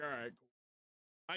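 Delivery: low, slow, deflated all right; resigned. Retold or imitated. Starving patient watching family eat, low resigned. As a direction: sigh it out, resigned. Can't eat before surgery.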